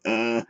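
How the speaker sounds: deep Beavis-style "uh"; dopey cartoon grunt; low dumb grunt. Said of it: "like Beavis and Butthead".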